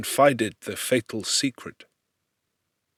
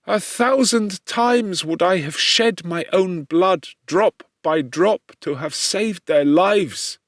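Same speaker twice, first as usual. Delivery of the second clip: raised, loud, slowed pleading; anguished confession; 6 semitones higher, 4 dB louder than usual. Acted. Victor confesses his guilt to his father, raised and anguished. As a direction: pour out the guilt, begging to be believed.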